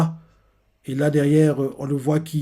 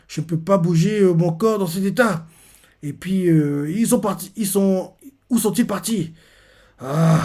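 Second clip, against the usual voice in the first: higher, strained, pained voice, acted tearfully; crying in pain; 5 semitones higher, 4 dB louder than usual. Hero in agony, unable to chase his friends, higher, strained and tearful. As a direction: play him hurting and near tears. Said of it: "on le voit qu'il pleure".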